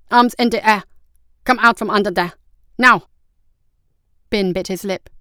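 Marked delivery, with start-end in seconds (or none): 0.1-3.1 loud commanding guard; shouted order. A narrator's reading. A guard above orders the brothers out, shouting. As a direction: shout orders, loudest on the last word. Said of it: "a voice shouted from above them".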